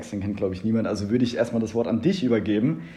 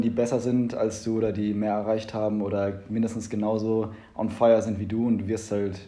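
normal talking voice

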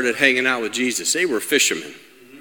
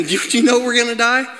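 high, fast, lightly acted alarm; mildly panicked question; 9 semitones higher, faster than usual. Disciples ask sleeping Jesus, mildly panicked question. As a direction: blurt it out as a worried plea.

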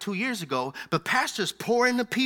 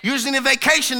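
louder, higher preacher shout; louder, fervent sermon exhortation; full-force preaching; 6 semitones higher, 7 dB louder than usual. Real, not acted. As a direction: call it out to the tired ones.

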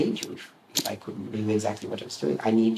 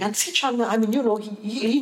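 much higher, stammering mock Milroy; imitating Milroy; 11 semitones higher than usual. Teller mimics Milroy fumbling for words, high-pitched. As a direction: squeak it out like the flustered Milroy. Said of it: "Milroy was going".

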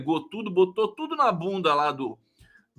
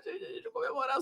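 very high, breathy squeal, dropping softer; celebrating; 12 semitones higher, 10 dB quieter, faster than usual. Real, not acted. Host repeating the celebration to viewers, high soft squeal. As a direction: squeal it like you can't contain the joy. Celebrating Dallagnol losing office.

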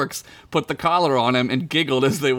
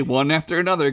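normal talking voice

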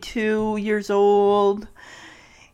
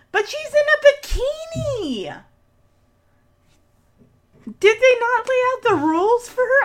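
much higher, outraged talking-to at the screen; incredulous scolding; 7 semitones higher than usual. Real, not acted. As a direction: scold her like a shocked big sister.

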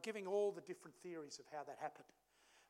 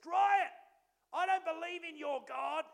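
high, loud call of a classmate's name; loud schoolboy taunt; 10 semitones higher, 10 dB louder, slower than usual. Retold or imitated. Classmate challenging Ryan in front of class, loud taunt. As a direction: fling it across the classroom. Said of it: "turns to me quite loud".

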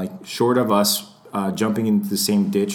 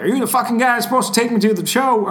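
imitated irate celebrity, pitched way up; huffy Southerner; 14 semitones higher, 4 dB louder than usual. Late celebrity to supposed chauffeur, in a huff. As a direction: play a Southerner indignantly demanding his driver. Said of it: "he was in a huff".

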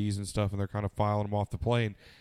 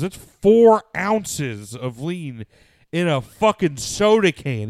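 very slow, higher and louder, every word hammered; slow emphatic quote; 7 semitones higher, 9 dB louder, much slower than usual. Retold or imitated. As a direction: spell it out like it's outrageous. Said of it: "He goes".

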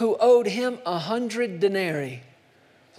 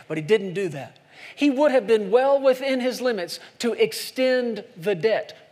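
normal talking voice

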